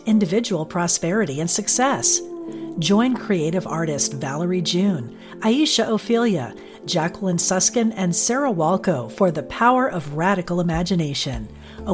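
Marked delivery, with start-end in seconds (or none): none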